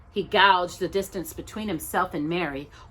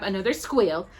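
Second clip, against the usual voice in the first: ordinary delivery, nothing marked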